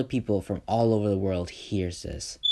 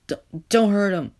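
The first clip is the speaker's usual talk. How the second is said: much higher, louder, stammered cry; frightened plea; 11 semitones higher, 8 dB louder than usual. Acted. Little kid on the floor begs the thug, scared high cry. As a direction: cry out to stop him, scared.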